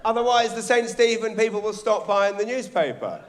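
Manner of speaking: mock exasperated editor. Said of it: "the editor says".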